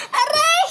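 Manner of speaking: pretend pain cry. Child scolded, crying out.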